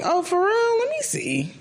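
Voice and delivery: high, surprised "for real?"; mock surprised. Reacting to friends saying you could do this, high mock surprise. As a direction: play it up like you can't believe it. Own eager reaction quoted.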